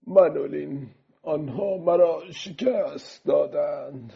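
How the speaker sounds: put-on high, slow, weary old man's voice; defeated old fisherman. Old fisherman telling the boy he was beaten, weary. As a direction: confess defeat slowly, letting the voice grow soft.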